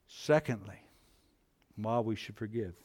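ordinary talk, nothing marked

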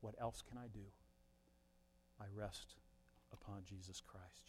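very soft, low, with pauses; quiet surrender. Acted. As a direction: rest into each phrase in a hush. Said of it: "that simply just says".

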